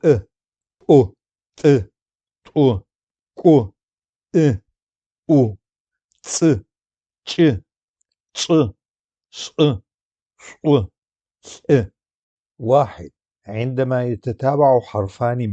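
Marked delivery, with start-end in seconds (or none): 0.0-11.6 each glottalized letter sound said alone, sharply. Shown as a demonstration. Teacher demonstrating glottal letters to students, sharp and separate. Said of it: "هي من نوع الأحرف المصمتة".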